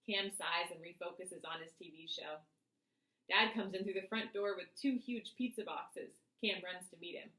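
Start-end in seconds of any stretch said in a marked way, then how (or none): none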